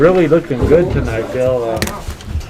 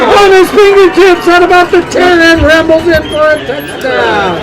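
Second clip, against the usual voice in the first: screaming touchdown call, much higher and louder; excited; 6 dB louder than usual. Real, not acted. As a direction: shout it as the pass is caught. Touchdown call on a long pass.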